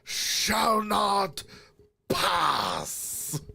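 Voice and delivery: booming wizard command; Gandalf shout. Retold or imitated. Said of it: "That's Lord of the Rings".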